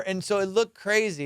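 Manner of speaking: normal talking voice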